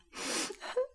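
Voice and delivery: high breathy sob; a sobbing gasp; owl sob. Acted. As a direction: owl breaking into sobs. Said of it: "gingen in Schluchzen über".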